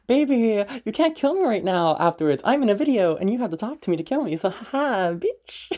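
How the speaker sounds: high, playful taunt; teasing. Real, not acted. Speaker taunting the watching partner through the video, teasing. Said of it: "me teasing my fucking Starlight".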